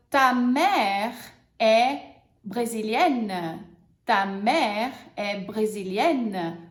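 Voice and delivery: slow, clear example; teacher models the sentence. Shown as a demonstration. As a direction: model it slowly. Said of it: "só pra gente treinar".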